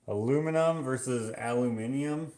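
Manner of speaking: "Aluminum" as "a-LOO-mi-num", then "aluminium" as "al-yoo-MIN-ee-um"; North American versus European. Shown as a demonstration. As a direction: contrast "Aluminum" with longer "aluminium". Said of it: "European versus, uh, North American way".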